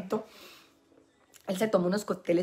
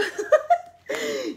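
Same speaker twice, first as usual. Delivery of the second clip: high laugh. Real, not acted. Mocking the court claim, high laughter.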